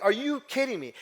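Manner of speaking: mother on the phone repeating herself, high incredulous yell; mock stunned yell. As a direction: press it again, still incredulous. Mother repeats her disbelief.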